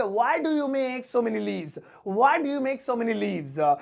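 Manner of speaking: "Why do you make so many leaves?" said very slowly; very slow model phrase. Shown as a demonstration. Teacher models the question very slowly to the class. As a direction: drag it out word by word. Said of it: "इसे English में कहेंगे".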